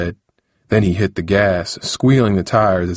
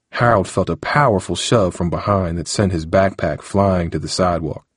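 plain reading voice

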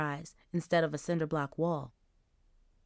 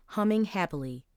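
plain reading voice

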